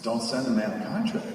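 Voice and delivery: ordinary delivery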